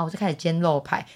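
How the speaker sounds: ordinary talk, nothing marked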